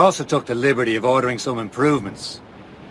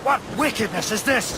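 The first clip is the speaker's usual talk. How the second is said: shocked cry; 8 semitones higher than usual. Acted. Seeing ocean set on fire.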